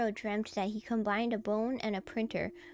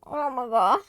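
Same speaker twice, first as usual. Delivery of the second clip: laughing apology; 3 semitones higher, 7 dB louder than usual. Real, not acted. Laughing apology after scaring herself.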